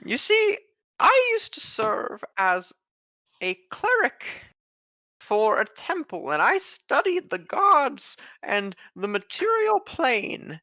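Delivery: put-on high, slow old woman voice; a sweet old shopkeeper granny, unhurried; old lady voice.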